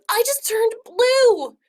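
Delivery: high, loud, slow yell. Acted. Girl discovering she turned blue: high yell. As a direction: cry it out high in dismay. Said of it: "Audrey yelled".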